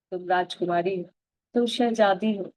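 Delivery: ordinary delivery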